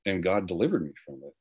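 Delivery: ordinary talk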